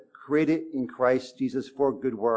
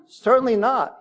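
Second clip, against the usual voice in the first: higher, louder emphatic denial; emphatic refusal; 9 semitones higher, 5 dB louder than usual. Retold or imitated. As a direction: read Paul's answer as a firm, emphatic no. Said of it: "Very emphatic".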